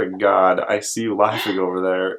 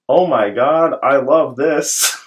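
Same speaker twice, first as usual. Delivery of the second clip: generic excited voice; 5 semitones higher, 3 dB louder than usual. Retold or imitated. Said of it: "the generic me going".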